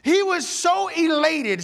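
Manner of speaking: ordinary talk, nothing marked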